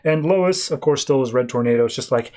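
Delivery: ordinary talk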